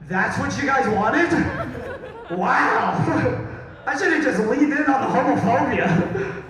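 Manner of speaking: loud, high-pitched disbelief, laughing; high, loud, laughing astonishment; incredulous outburst. Real, not acted. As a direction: exclaim in amused amazement at the crowd. Mock disbelief at the audience's reaction.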